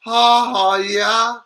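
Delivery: loud, high drawn-out holler; catchphrase shout. Pretend. Streamer performing a viewer's requested catchphrase, shouted. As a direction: belt it out and hold the notes. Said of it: "can I get a ha ha yeah".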